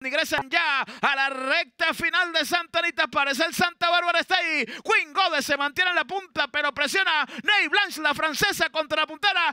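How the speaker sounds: live race call building to a shout; horse race call. Real, not acted. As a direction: call the stretch run, rising to the finish.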